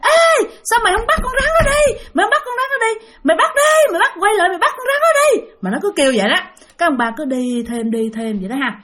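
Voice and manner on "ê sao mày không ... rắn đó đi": bully him with high shrieks; high shouting demon ordering the farmer; raging ghost yell. Acted. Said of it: "vừa kêu mà vừa làm rần rần rần".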